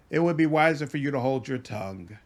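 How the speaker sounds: usual speaking voice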